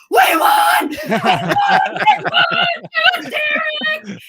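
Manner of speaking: screaming high and loud with joy, laughing; ecstatic shout. Retold or imitated. Photographer sprinting down the hallway shouting the win, ecstatic and laughing. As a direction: yell it like the buzzer just went your way. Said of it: "He's like".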